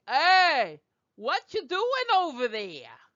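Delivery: high, mock Brooklyn street call; Brooklyn accent. Shown as a demonstration. Teacher jokes about the wrong vowel, hammed-up Brooklyn accent. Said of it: "it's not like Brooklyn".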